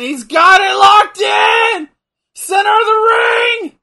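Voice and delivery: high, loud, slowed play-by-play shout; wrestling commentator. Real, not acted. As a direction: call it like a ringside announcer. Calling the hold like a commentator.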